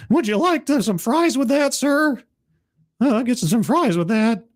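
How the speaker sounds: high-pitched adolescent server; pubescent teenager. Acted. Teen taking a customer's fries order, high pubescent voice. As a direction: push the voice way up like a teen in puberty. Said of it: "Scribe is finally going through puberty".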